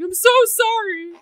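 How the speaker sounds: very high, loud, squeaky apology; flustered apologetic coworker. Retold or imitated. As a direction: squeal out a panicked sorry. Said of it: "He's like".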